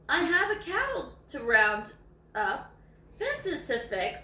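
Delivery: lively read-aloud voice, savouring each line; story reading. A narrator's reading. Reading a dog's story to viewers, lively read-aloud.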